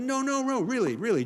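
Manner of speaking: high, loud, insistent protest; eager insistence. Retold or imitated. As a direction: wave her off, eager to grab the shovel. Insisting on shoveling before neighbors.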